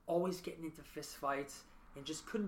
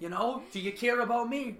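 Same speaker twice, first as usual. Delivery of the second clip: louder, higher plea to be noticed; pleading for attention; 6 semitones higher, 6 dB louder than usual. Retold or imitated. Daughter to mother, raised pleading voice. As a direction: cry out to be seen by a busy parent.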